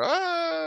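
high, drawn-out "aah"; mock falling scream. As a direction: imitate someone tumbling from the sky. Imitates tumbling through the air.

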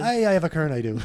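high, loud hammed-up Irish reply; mock Irish accent. [name] plays along, hammed-up Irish accent. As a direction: answer like a proud Irish camper. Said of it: "in an Irish accent".

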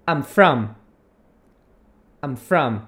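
"I'm from." clipped, low, "frɐm"; model for repeating. Shown as a demonstration. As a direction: say "I'm from." for students to copy. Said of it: "repete aí comigo". ("I'm from." para repetir)

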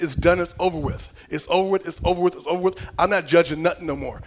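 loud, high, fervent preaching; intense preaching. Acted. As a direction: preach it out at full force. Preaching at full force.

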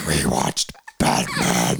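very low, slow growl; deep, deliberate superhero growl; Batman. Shown as a demonstration. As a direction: drag it out in a deep hero voice. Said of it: "you have to say it like".

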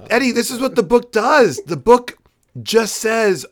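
high and loud outburst about the book; can't believe what the book does; exasperated. Real, not acted.

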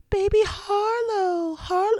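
imitating kids' high, drawn-out cooing at a baby; baby-talk pitch. Kids copying mom greeting the baby, high baby-talk. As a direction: go way up and stretch it, as to a baby. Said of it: "go up, um, an octave apparently".

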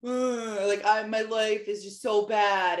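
lightly voiced sulky teen groan; mopey teenager. Mother imitating her mopey teenage son's complaining. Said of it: "that mopey".

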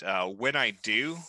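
normal talking voice

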